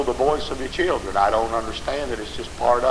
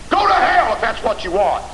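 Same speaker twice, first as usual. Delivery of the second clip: loud, higher-pitched outburst; scornful shout; 10 semitones higher, 5 dB louder than usual. Acted. Preacher to defiant parents, scornful shout. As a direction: throw it out as a sudden shout.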